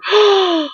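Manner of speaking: high, theatrical gasp; mock shocked gasp. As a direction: gasp as if scandalized by gossip. Mock shock at announcing a rumor.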